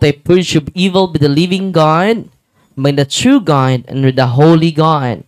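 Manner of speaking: formal blessing. Acted. Priest blesses the salt for the congregation, solemn and loud. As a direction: proclaim the rite of blessing.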